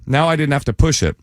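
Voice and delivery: ordinary talk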